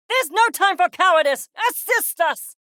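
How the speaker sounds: urgent command. Acted. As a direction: call urgently for help. Urgent order amid danger.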